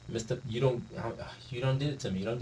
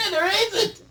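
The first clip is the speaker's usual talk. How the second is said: laughing; 4 dB louder than usual. Real, not acted. Mocking high laugh.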